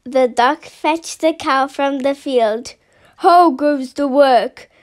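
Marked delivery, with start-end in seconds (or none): 3.2-4.7 lightly voiced lazy farmer calling out; a hint of the farmer's voice. A narrator's reading. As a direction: call it out like a lazy farmer from bed. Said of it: "called the farmer".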